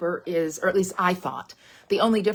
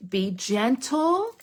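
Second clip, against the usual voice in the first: slow caution; 3 semitones higher, much slower than usual. Shown as a demonstration. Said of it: "Now, I will say".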